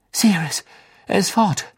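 called out. A narrator's reading. Harry calling to Sirius that Pettigrew escaped, urgent. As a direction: call the warning across the field. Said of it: "rief Harry".

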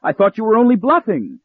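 protest, stunned; horrified accusation; very high shocked protest. A narrator's reading. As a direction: pitch jumps up in disbelief. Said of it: "I cried".

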